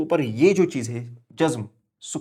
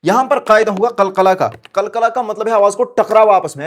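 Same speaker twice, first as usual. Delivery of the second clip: emphatic; 7 semitones higher, 8 dB louder than usual. Real, not acted. Teacher stresses the rule.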